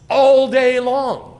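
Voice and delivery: very loud, high, stretched-out proclamation; emphatic cry. Shown as a demonstration. Preacher repeating God's persistence to the congregation, emphatic cry. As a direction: belt it out, holding each word. Verse phrase hammered for emphasis.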